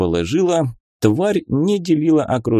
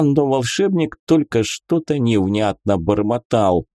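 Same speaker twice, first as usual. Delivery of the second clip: plain reading voice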